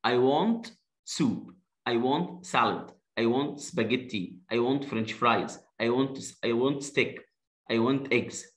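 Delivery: slow, clear teacher drill. Shown as a demonstration. Teacher drilling a class, slow and clear. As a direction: drill each sentence slowly for the kids. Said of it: "هطلع فوق كده".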